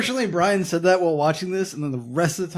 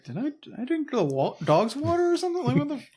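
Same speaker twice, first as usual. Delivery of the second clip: bewildered farmer; 9 semitones higher, 3 dB quieter than usual. Retold or imitated. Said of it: "Haggart was like".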